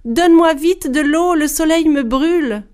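wife pleading desperately as the sun burns; crying out. A narrator's reading. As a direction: cry out to her husband in pain. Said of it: "se mit à crier".

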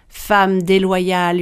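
ordinary delivery

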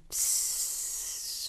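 whispered hiss; a sly snake's psst. Acted. The snake hisses at the hippo, whispered. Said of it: "il persifla".

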